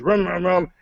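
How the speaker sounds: rolling vroom noises; imitated engine revving. Storyteller imitating a driver revving at lights, engine noise. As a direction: mimic revs at a red light. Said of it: "He goes".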